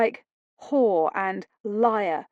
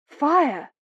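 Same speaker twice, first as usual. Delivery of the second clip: loud, higher shout of warning; alarm cry; 6 semitones higher, 4 dB louder than usual. Acted. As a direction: raise the alarm.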